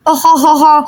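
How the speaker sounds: low mocking cackle; gloating laugh. Chintu laughing at Sonam's accident, low and mocking. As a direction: cackle at her misfortune.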